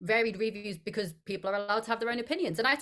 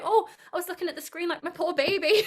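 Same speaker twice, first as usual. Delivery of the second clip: imitating her mum's anguish at the screen; doting mum; 8 semitones higher than usual. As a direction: cry out like a distraught mum. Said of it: "She was like".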